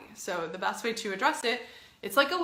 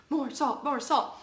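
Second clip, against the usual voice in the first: higher-pitched mock demand; playful, repeated begging for more; insistent craving; 4 semitones higher, slower than usual. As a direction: chant it like a greedy little craving. Mimics her own insistent craving.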